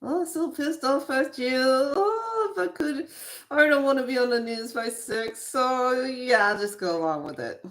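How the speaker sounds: high, sing-song fake cheer over anger; mock sweetness, seething underneath; fake smile. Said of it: "you put the fake ass smile on your face and you're upset".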